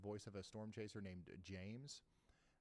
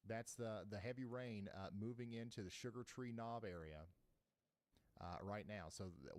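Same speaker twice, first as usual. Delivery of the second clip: ordinary delivery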